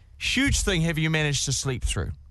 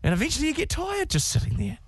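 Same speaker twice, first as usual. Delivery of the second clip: ordinary talk, nothing marked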